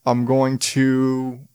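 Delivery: ordinary delivery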